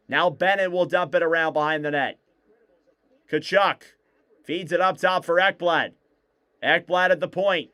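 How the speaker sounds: play-by-play. Acted. Live hockey play-by-play.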